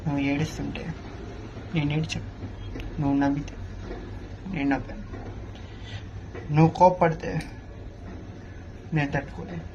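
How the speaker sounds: soft, tender love lines for the heroine; romantic film hero. Acted. Actor on set reciting love lines to the heroine, softly. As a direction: woo her gently, like a film hero.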